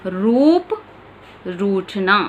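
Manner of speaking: long ू in "रूप", "रूठना" demonstrated; long u demo. Shown as a demonstration. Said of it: "इसका उच्चारण होता है".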